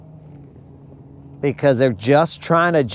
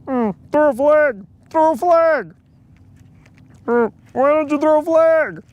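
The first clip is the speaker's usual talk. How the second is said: much higher, nasal-free whiny mock plea for a flag; whiny quarterback impression; 11 semitones higher than usual. Mocking the quarterback begging refs for a flag, high whiny voice. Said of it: "He's like".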